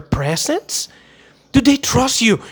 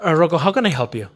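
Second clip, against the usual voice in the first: mock salesman; 4 semitones lower, faster than usual. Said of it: "What are you gonna say?".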